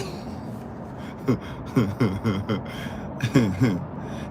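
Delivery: low, soft chuckle; long quiet chuckle at your own joke; drawn-out run of soft laughter; laughing. Real, not acted. Laughs at own teasing remark.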